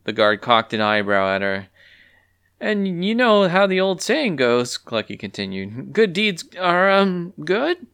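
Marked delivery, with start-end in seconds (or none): 2.6-4.8 bluffing hen. Acted. Clucky bluffing the guard. 5.9-7.9 higher, trailing into a doubtful question; hope the saying lands; unsure hen. Acted.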